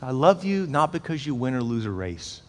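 soft, slow, tender reassurance; tender father. Retold or imitated. Father pulling his son close, tender and soft. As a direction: say it close and quiet.

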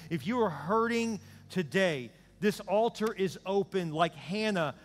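high, loud impassioned preaching; altar call. Acted. Preacher calling the hurting forward, impassioned. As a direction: urge them forward with fervour.

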